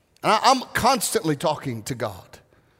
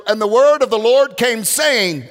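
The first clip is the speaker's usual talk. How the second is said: loud, higher, grand mock prophet; imitating prophecy voice; 4 semitones higher, 7 dB louder than usual. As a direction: boom it out like a church prophet. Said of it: "he spoke in King James".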